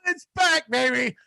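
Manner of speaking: high, hyped cheer; excited. Real, not acted. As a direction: announce it like a jubilant fan. Celebrating football's return.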